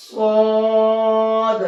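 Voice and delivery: صاد heavy and full-mouthed, drawn out slowly; full-mouthed saad. Shown as a demonstration. Teacher showing students a heavy صاد. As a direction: fill the mouth and say صاد thick. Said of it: "اس کو تھوڑا سا منہ بھر کے پڑھیں گے".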